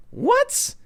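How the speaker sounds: sudden high squeak of disbelief; mock disbelief. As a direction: jump way up in pitch, faking shock. Mocking a brain-on reaction.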